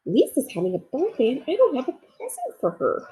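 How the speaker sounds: a small toy bear fretting to himself; little bear Corduroy. Acted. Narrator voices Corduroy the bear.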